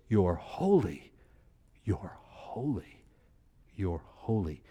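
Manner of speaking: slow, falling to a breathy whisper; reverent whisper. Shown as a demonstration. Teacher showing true worship to the class, whispered and slow. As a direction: breathe you're holy like a prayer. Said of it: "No, it's".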